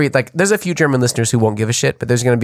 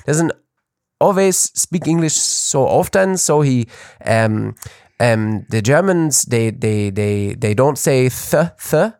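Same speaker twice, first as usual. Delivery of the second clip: mock German accent, sing-song; halting, slow German-accented English. Shown as a demonstration. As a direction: imitate a German unused to speaking English. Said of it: "My impression sometimes of a German person".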